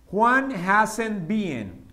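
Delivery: Juan hasn't been, stretched word by word; slow it right down for learners; slow dictation for the class; very slow model. Shown as a demonstration. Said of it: "¿Cuál será la pronunciación?".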